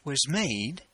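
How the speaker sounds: dramatic poetry recitation with long pauses; poem reading. Acted.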